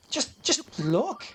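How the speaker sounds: high, slow, awed; mock awe. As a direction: gawk at it in wonder.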